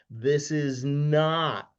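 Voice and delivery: each word drawn out slowly; slow and deliberate. Real, not acted. Speaker warns the group what co-lending is not, slow and deliberate. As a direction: spell it out very slowly, stretching NOT. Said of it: "I'm gonna say this again really slow".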